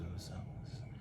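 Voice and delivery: breathy mock mumble; imitating the low-talk habit; whispered mumble. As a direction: whisper it lazily. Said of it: "I'm, like, talking low".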